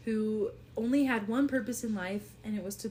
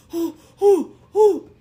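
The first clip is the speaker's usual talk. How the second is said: high, loud, laughing frightened oh's; panicked gasps; 8 semitones higher, 16 dB louder, slower than usual. Acted. Harrington freaking out at the symbol, loud gasping oh's. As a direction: gasp out in terror. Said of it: "And he's like".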